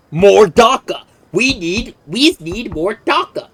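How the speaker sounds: loud, high brutish orc chant; orc war cry. Retold or imitated. Streamer quoting the orcs' craving for guns, hammed-up orc voice. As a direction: bellow it like a greedy orc. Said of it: "It is the orc thing of".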